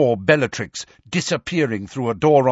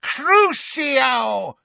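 high, drawn-out screamed curse; villainess's high shriek of a spell; 12 semitones higher than usual. A narrator's reading. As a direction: scream the spell, stretching the vowels. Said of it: "when she screamed".

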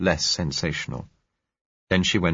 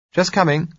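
put-on raised, lighter young woman's voice; 12 semitones higher, 5 dB louder than usual. A narrator's reading. Young woman claiming the bedroom, light and high. Josella calling back.

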